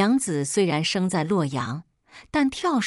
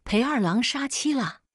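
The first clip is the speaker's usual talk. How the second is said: shouting out; 6 semitones higher than usual. A narrator's reading. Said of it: "我大嚷".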